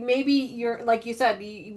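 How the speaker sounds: ordinary talk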